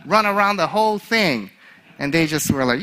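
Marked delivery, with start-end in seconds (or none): 0.0-1.5 mild yell, pitched up; raised-pitch retold shout. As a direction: call it out like a coach to the class. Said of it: "And I yelled at them".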